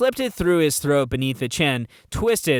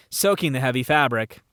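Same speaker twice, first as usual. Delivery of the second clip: ordinary delivery, nothing marked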